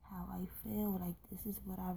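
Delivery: hushed, near-silent whisper; soft confiding whisper. Real, not acted. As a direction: whisper it like a secret you barely admit. Confiding that nobody understands.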